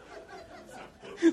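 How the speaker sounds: high breathy laugh. Real, not acted. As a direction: break into laughter. Laughing at responses.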